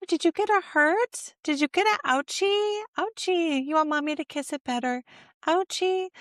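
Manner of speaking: put-on high sing-song baby talk; high, sing-song mommy voice asking about an ouchie; soothing parent to toddler. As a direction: coo to a hurt toddler. Parent talking to hurt toddler.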